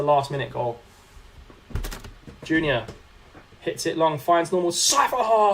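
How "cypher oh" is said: sudden high, loud call of the name; excited shout. Real, not acted. Streamer calling out a near goal, excited. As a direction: yell it as the chance opens.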